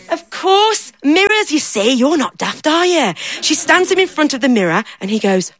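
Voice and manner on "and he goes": ordinary delivery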